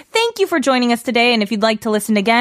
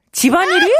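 questioning rise, higher; 6 semitones higher, much faster than usual. Shown as a demonstration. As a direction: ask it again higher. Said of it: "repeated higher".

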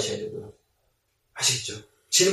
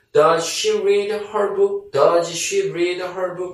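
1 slow model sentence; 2 semitones higher, much slower than usual. Shown as a demonstration. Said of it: "slow model sentence for learners".

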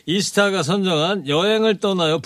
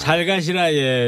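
light Gyeongsang dialect goodbye; mock Gyeongsang farewell; slower than usual. Host tries a Gyeongsang girl's goodbye, light dialect. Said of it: "뭐 이렇게 하나요".

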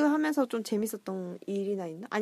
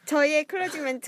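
cutesy aegyo; 5 semitones higher, 3 dB louder than usual. Pretend. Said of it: "제가 애교를".

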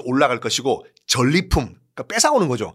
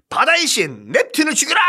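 roaring command; 11 semitones higher than usual. Acted. Emperor orders killing the sea god.

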